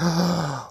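sharp acted intake of breath; shocked gasp. Mom seeing her bloodied son, shocked gasp. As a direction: imitate a horrified gasp. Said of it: "just gasps".

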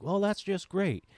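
unfazed Southern dad. Retold or imitated. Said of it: "He's like".

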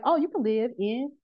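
high, breezy mock sell of an easy commute; lightly voiced locals making it sound close; mock salesy reassurance. To a podcast host, mimicking people who swore the commute was short, high and breezy. Neighbors pitching Sandy Springs.

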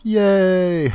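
high, drawn-out yay; imitated gleeful hooray; mock cheer. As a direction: cheer like a delighted cartoon duo. Both characters cheer together.

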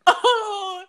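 high, loud dawning "Oh"; imitating friend's realization. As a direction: pitch the "Oh" way up like it finally clicks. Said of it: "And she's like".

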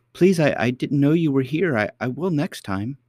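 put-on high hesitant little girl; cartoon princess. A narrator's reading. Princess hesitant to old lady.

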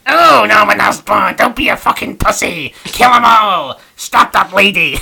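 loud, high, slow taunting goblin; bully him from inside his head; hammed-up shouting inner goblin. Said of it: "a voice in his head going".

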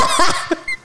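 laugh. Real, not acted. Risa tras la anécdota.

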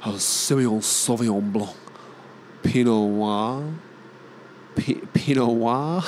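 affected French wine names; slow, mock-French "pee-no wah" for Pinot Noir; wanky French pronunciation. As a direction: drag out Pinot Noir pretentiously. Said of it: "not as wanky as saying Semillon Sauvignon Blanc".

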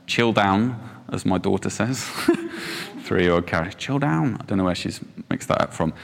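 normal talking voice

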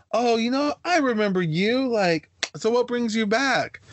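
high-pitched mock counselor voice; imitating the counselor. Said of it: "the counselor finally asked, she's like".

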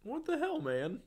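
high, incredulous outburst; shocked disbelief; surprised. Real, not acted. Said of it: "that's what I'm saying".

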